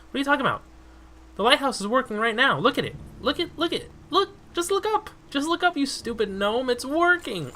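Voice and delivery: high, loud exasperated retort; indignant high voice. Acted. Player yells back high at the stupid gnome NPC.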